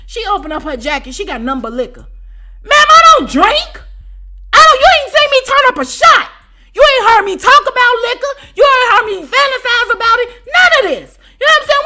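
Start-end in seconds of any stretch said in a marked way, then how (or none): 2.6-11.8 high, loud incredulous rant; outraged shout. Retold or imitated. Telling the ex-roommate off about liquor, outraged shout. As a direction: yell it in disbelief.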